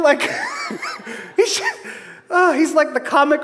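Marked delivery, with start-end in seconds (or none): 0.2-1.3 sudden squealing burst of laughter; shrieking high laugh. Real, not acted. Laughing at own Peter joke.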